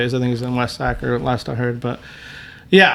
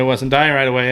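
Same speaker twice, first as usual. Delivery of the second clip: ordinary delivery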